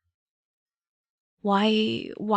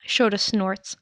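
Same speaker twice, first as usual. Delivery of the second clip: ordinary delivery, nothing marked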